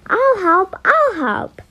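high, loud excited shout; eager shouting. A narrator's reading. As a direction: shout to be picked. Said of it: "they shouted out".

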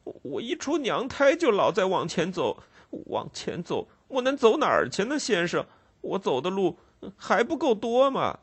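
high, rushing tearful plea; crying boy. Acted. Ragged boy crying to the constable, high and desperate. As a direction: wail it out as a desperate child. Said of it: "一边哭着说".

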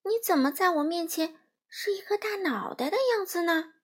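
high-pitched bewildered question; puzzled. A narrator's reading. Dorothy asking about the giant head, puzzled. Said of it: "多罗西迷惑地说".

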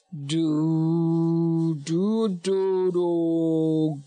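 long, low creature roar; big beast bellowing low and steady; Big Totoro roar. A narrator's reading. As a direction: swell a deep drawn-out roar on held syllables. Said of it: "roared Big Totoro".

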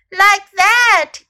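high, very loud exclamation; crying out. A narrator's reading. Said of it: "cried Snake".

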